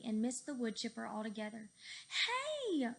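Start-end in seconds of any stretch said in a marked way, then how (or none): 2.1-2.9 high, loud startled cry; cried out. A narrator's reading. Fluffy as the plane swerves away, high startled cry. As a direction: call out in surprise. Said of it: "cried Fluffy".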